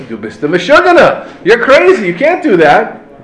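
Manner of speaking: loud scolding, you're crazy; a hint of alarmed onlookers shouting him down; mock rebuke. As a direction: chide him like onlookers shocked. Bystanders rebuking the smasher.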